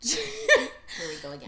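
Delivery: loud laughing groan. Real, not acted. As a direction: laugh through it, amused exasperation. Laughing mock exasperation.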